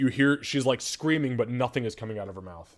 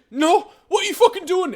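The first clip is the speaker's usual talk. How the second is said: high, loud frantic scream; 14 dB louder than usual. Acted. Mother screaming at her son to keep away from the body. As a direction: shriek to stop him.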